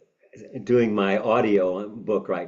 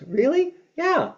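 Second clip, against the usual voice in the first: high, bright little "really?"; a toddler's delighted surprise, much higher; surprised child; 4 dB louder than usual. Acted. Comforted child answers daddy, high and surprised.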